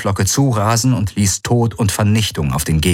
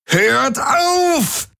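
very high, strained desperate yell; roaring. A narrator's reading. Jun screams at everyone to stop, very high and desperate. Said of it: "brüllte Jun".

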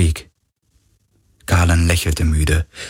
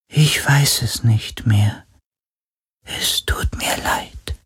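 faint, breathless spirit whisper; a sorrowful ghost, barely voiced; ghostly whisper; 4 semitones higher, slower than usual. Ghost voice answers Galen.

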